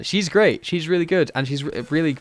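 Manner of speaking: usual speaking voice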